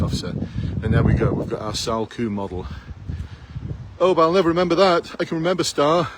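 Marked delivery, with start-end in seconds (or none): 4.0-6.1 high, louder whiny objection; sarcastic imitation of a stubborn recruit; mock candidate protest. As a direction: parody a candidate who won't learn a new model.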